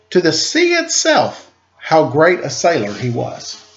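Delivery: storybook read-aloud, gently paced; story narration. A narrator's reading. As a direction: read it like a picture book to children. Reads a picture book aloud.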